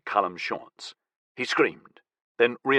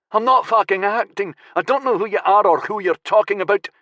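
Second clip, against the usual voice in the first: high, loud desperate protest; a terrified man insisting on his innocence; frightened young captive; 9 semitones higher, 5 dB louder than usual. Acted. Captive protesting to his interrogator, high and loud.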